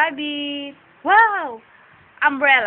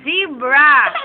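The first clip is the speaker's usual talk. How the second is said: louder, rising call of zebra to copy; prompting a toddler; 9 dB louder than usual. Shown as a demonstration. Mom prompting her child what to tell Daddy, louder rising call. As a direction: sing out Zebra with a lift at the end. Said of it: "Sabi mo kay Daddy".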